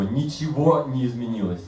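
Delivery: normal talking voice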